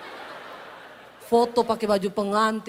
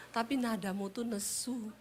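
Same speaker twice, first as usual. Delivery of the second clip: much softer reply; soft husband; 11 dB quieter than usual. Retold or imitated. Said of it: "Dia jawab".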